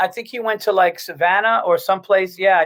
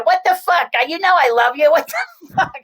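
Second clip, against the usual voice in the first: Bobby Kelly; 10 semitones higher than usual. Retold or imitated. Friend calling after his number is shared, high mock outrage. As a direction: squeal it like an outraged buddy on the phone. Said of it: "Bobby Kelly's gonna call you".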